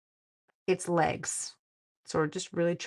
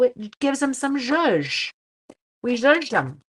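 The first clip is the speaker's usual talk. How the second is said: playful zhuzh; 6 semitones higher, 7 dB louder, slower than usual. Real, not acted. Playful new word.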